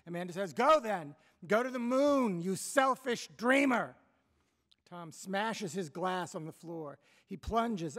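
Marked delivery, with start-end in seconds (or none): 0.5-3.9 high, loud final outburst; furious mother. Acted. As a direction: hurl it after him as he leaves. Mother's last furious retort.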